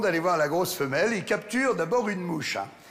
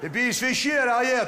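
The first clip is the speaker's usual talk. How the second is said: normal talking voice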